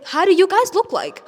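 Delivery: pitched high, mock-baffled question; incredulous retort. Answering the bullies, high incredulous retort. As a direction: toss it back like it makes no sense. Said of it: "I say".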